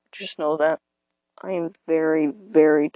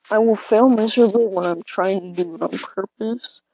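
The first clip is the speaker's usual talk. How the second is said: normal talking voice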